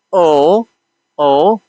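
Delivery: او as a long, drawn-out "oh"; sound demonstration. Shown as a demonstration. Teacher showing learners the O sound, pronounced clearly. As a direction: say او as one held O vowel. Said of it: "which we say O".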